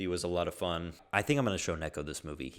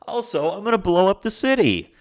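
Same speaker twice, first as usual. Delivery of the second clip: high, loud hammed-up villain voice; mock villain; 13 semitones higher, 12 dB louder than usual. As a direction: ham it up like a cartoon villain announcing his plan. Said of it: "he was just like".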